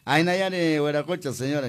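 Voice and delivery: cheering across the fiesta; festive call-out. Real, not acted. Host repeats festive call.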